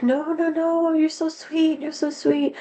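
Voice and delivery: high, gushing insistence in a put-on voice; gushing stranger. Tourist pressing a tip into a stranger's shoes, gushing high and insistent. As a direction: pile on the sweetness like a grateful tourist. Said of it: "And she's like".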